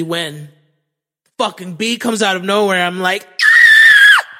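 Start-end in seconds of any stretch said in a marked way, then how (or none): 3.4-4.3 piercing high, loud scream; frightened shriek. Retold or imitated. Man imitating himself seeing a bee, high shriek. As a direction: shriek in mock terror.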